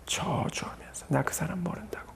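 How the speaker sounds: whispered, very soft retelling; hushed denial. Retold or imitated. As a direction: drop almost to a whisper. Peter's denial, dropped to whisper. (속삭이듯 낮춘 목소리로)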